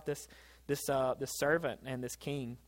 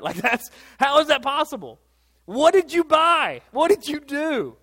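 incredulous; 11 semitones higher, 10 dB louder than usual. Acted. Incredulous preacher voicing listeners.

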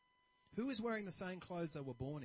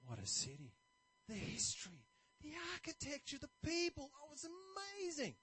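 delighted traveler; 10 semitones higher than usual. Retold or imitated. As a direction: swoon over the memories. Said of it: "he's really happy".

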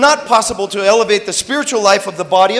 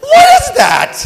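high incredulous shout; outraged disbelief; 4 dB louder, slower than usual. Real, not acted. Outraged reaction to rule.

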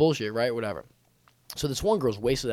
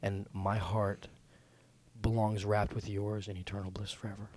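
mock love declaration; 6 semitones lower than usual. Wooing Camila if she's listening, mock-tender. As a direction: croon it tenderly, pretending utter devotion.

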